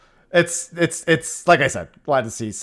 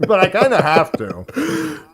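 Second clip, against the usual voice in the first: slow, higher, louder through a mouthful; mouth full, emphatic; 10 semitones higher, 7 dB louder, slower than usual. Real, not acted. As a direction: drag it out with food in mouth. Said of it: "I don't wanna talk with my mouth full".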